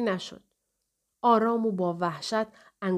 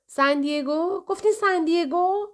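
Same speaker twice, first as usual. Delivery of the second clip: high, louder rising questions; questioning double-check; 10 semitones higher, 4 dB louder than usual. A narrator's reading. Operator checks the city with a faint caller, high and questioning. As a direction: query it loudly, pitched right up.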